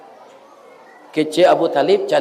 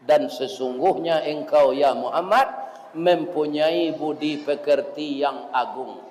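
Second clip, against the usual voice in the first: ordinary talk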